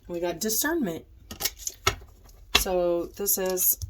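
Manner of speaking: nasal voice from a stuffed-up nose; blocked nose. Real, not acted. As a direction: speak through a blocked nose. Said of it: "I'm all stuffed up, so forgive my, um, nasally voice".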